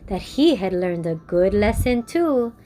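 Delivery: gentle, measured storyteller close; story narration. A narrator's reading.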